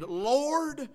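high, loud pleading cry of a humble soldier; centurion voice. Acted. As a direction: cry out unworthiness loudly. Preacher voicing the centurion.

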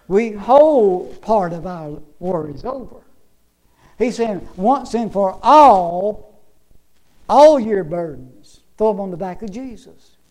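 loud, emphatic sermon delivery; forceful preaching. Real, not acted. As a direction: hammer the key words, stretching them out.

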